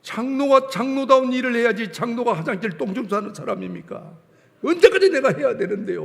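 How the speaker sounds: rising indignant grumble to God; retold complaint. Elder grumbling to God about cleaning toilets, indignant. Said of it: "불평을 하기 시작하는 거예요".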